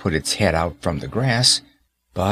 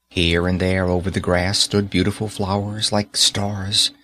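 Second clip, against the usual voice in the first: ordinary delivery, nothing marked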